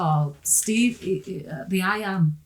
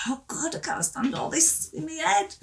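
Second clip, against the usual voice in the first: overwhelmed; 10 semitones higher, 3 dB quieter than usual. Retold or imitated. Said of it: "I was just like".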